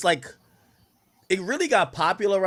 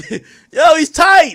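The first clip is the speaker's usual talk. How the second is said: much higher and louder, laughter in it; laughing hype shout; 13 semitones higher, 15 dB louder, much slower than usual. Real, not acted. Streamer losing it over the clip, laughing hype shout. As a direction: yell it while still laughing.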